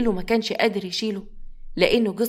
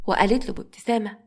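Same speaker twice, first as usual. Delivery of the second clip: ordinary delivery, nothing marked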